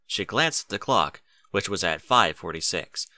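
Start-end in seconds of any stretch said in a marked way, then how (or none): none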